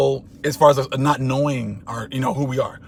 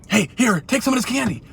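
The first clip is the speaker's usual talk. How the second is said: much higher, pushy panicked begging; frantic offer; 10 semitones higher than usual. Retold or imitated. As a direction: shove the candy at him in mock panic. Desperately offering candy to share blame.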